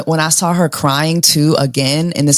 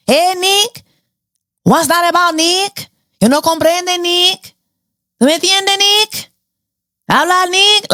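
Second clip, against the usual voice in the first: high-pitched teasing call to Nick in mock Spanish; mocking Spanish taunt; 11 semitones higher than usual. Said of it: "why he changing his accent for Andrina".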